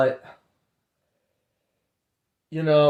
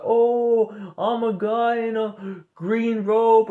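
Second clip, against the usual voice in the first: higher, slow put-on voice of a scheming being; sweetly lure, pitched up and drawn out; mock lizard being; 7 semitones higher, much slower than usual. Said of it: "the lizard beings are like".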